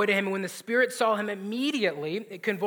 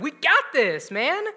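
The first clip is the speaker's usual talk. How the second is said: a brash, louder "we got this"; cocky boast; 7 semitones higher, 5 dB louder than usual. Retold or imitated. Preacher voicing the disciples' cocky boast. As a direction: toss it off like overconfident buddies.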